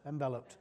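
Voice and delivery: soft, low "Enveloped" as en-VEL-upt; short-e pronunciation. Shown as a demonstration. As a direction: settle on "Enveloped" with stressed VEL. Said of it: "Is it enveloped or enveloped?".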